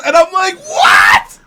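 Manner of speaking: high, loud disbelieving shriek; real stunned yell; shocked yell. Real, not acted. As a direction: scream it in disbelief. Shocked reaction to the line.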